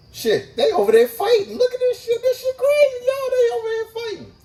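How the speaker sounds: high, gleeful squeal watching a fight; high-pitched excited narration of a fight; excited high gossip. Retold or imitated. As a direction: squeal with delight at the drama.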